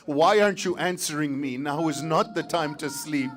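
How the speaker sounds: anguished summons rising to a plea; calling out. Acted. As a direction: call the names as if across a battlefield. Calling on his fallen companions.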